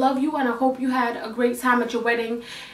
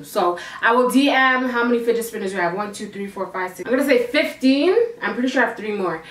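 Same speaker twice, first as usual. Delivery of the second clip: ordinary talk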